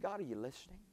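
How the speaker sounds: soft, hushed question to heaven; almost too tired to ask; doubting preacher asks God softly; quiet plea. Retold or imitated. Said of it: "and I said".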